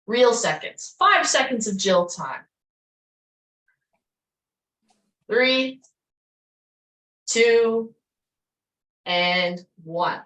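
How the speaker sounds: loud, punchy countdown calls; coach countdown. Real, not acted. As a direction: count them down with energy. Coach calling countdown to class.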